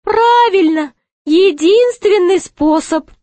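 very high jester voice; fretting jester. A narrator's reading. Jester waits for the gate to open, fretting high. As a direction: fret in a high-pitched jester's voice.

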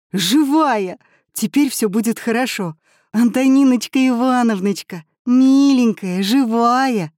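joyful relief. A narrator's reading. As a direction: cry out with happy relief. Olya hugs found teacher.

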